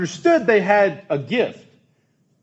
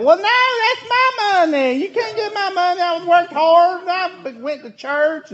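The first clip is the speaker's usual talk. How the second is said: much higher, laughing, put-on old Southern lady; old Southern belle. Pastor mocks a churchgoer refusing to give money, as a high laughing Southern belle. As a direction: play a huffy old Southern belle clutching her money. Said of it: "the old Southern Belle woman comes out in me".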